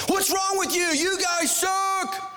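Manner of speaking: high, slow mock yell at toys; child's mock shout at action figures; kid yelling. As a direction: imitate the boy yelling at his toys. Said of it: "my son's yelling at his action figures".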